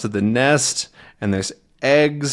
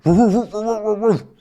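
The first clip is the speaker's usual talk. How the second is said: dog bark; 10 semitones higher than usual. Acted. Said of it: "That's my dog bark".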